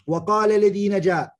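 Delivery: fast, chanted verse recital; Quran recitation. Acted.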